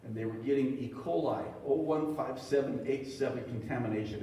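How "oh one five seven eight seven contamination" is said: ordinary talk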